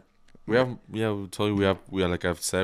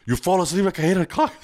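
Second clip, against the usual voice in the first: high mocking jab; mocking teasing voice; 10 semitones higher, 5 dB louder than usual. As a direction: tease him in a high voice. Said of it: "and it was like".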